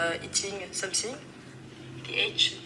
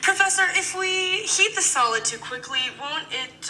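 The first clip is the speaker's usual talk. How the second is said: put-on much higher voice with a French accent; French student voice; 11 semitones higher than usual. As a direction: ask the professor like a French student, high. Plays French student role.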